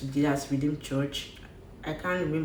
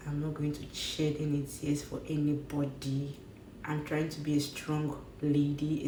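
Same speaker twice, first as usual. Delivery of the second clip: usual speaking voice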